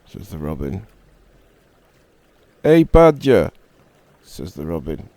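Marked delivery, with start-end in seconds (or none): 2.6-3.5 loud high call across the field. Acted. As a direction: shout for the badger. Robin calls out to badger.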